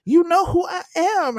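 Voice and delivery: high, loud entitled brush-off; haughty scammer. Retold or imitated. Scammer brushing off the hotel desk, high haughty voice. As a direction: play the con woman who thinks her name opens doors. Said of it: "Miss Lady was like".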